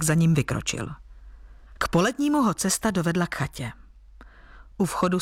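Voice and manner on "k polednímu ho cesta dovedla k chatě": ordinary delivery, nothing marked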